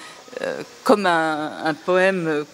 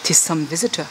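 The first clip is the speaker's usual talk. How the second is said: poem recital; 3 semitones lower than usual. Shown as a demonstration. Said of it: "c'est les sonorités".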